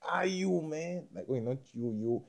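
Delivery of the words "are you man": raised, slow, indignant demand; mock confront a stranger; higher, drawn-out who-are-you challenge; confrontational challenge. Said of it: "it's like".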